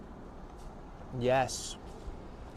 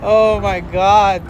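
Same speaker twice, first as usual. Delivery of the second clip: laughing; 3 semitones higher, 8 dB louder, much slower than usual. Real, not acted. Laughing after the stunt.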